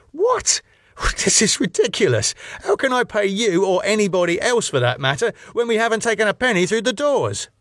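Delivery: high, fast, incredulous protest; outraged disbelief climbing high; utter disbelief. Acted. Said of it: "with utter disbelief".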